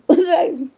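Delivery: sudden high laughing burst; loud cackle. Real, not acted. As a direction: crack up out loud. Laughs loudly.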